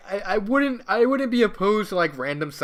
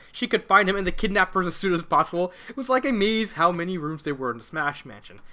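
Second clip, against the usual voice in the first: plain reading voice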